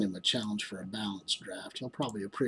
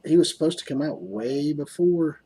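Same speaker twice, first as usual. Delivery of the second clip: worked up; 6 semitones higher, 9 dB louder than usual. Real, not acted. Frustrated about character release.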